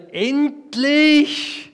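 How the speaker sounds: hoher, lauter Jubelruf; freudiger Ausruf. Acted. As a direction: ruf es hinaus vor Glück. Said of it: "rief Adam aus". (Adam ruft beim Anblick Evas laut und hoch aus)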